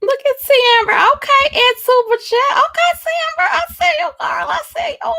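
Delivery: extremely high, playful squealed shout-out; silly back-and-forth voice. Pretend. Streamer in back and forth with Amber, silly high voice. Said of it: "It's just a silly back and forth".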